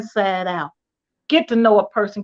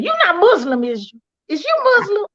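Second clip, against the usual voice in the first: playful mock questioning, rising higher; teasing; 8 semitones higher than usual. Woman playfully questions her sister's faith, teasing. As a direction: needle her with a laugh ready. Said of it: "I always tease her".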